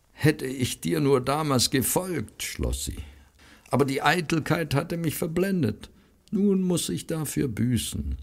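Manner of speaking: lightly voiced weary woman confessing her regret; remorseful sister. A narrator's reading. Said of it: "von Schluchzen unterbrochen".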